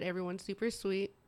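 ordinary talk

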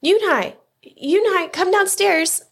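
high, carrying mother's call; calling upstairs. A narrator's reading. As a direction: call the child down from below. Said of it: "Mother called up to Yunhai".

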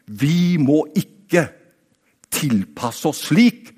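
very slow, each word weighed; a slow, deliberate warning; slow emphatic. Acted. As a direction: lay it down slowly, word by word. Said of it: "Han sier".

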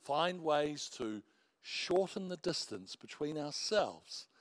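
normal talking voice

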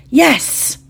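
high, bright shout of triumph; triumphant cheer. A narrator's reading. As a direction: cheer the small victory. Triumph after locking door.

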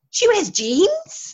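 very high, slow, loud mock shock; incredulous kids. Schoolchildren shocked by the dairymaid's jeans, incredulous. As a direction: stretch it out as a disbelieving question.

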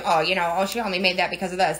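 low, fast sneering imitation of a jealous rival; mocking gossip voice. Mimicking jealous gossip about her.

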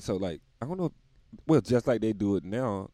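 ordinary talk, nothing marked

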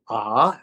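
low, obedient "Ah" repeated back; open "ah". Retold or imitated. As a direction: say "Ah" with an open vowel. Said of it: "can you say ah".

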